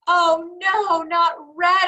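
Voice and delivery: hammed-up cry of horror; mock screaming not red at the mirror; storybook scream. A narrator's reading. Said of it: "I screamed".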